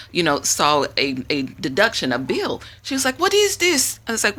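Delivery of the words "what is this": surprised. Retold or imitated. Caller sees bill, surprised.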